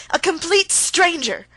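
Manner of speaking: slow, loud, high and wounded; pained wrath. A narrator's reading. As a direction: let the word stranger hurt. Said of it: "With pain and wrath she uttered".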